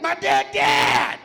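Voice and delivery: slow, high, loud wail; hammed-up grieving shout; anguished cry. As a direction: drag out a mock anguished shout. Coworker's anguished outburst.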